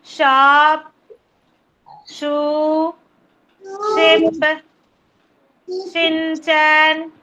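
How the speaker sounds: "shop" through "shark" one at a time, held on a level tune; phonics list recap. Shown as a demonstration. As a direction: chant out "shop", "shoe", "ship" and the rest slowly, clear sh on each. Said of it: "things that start with sh sound".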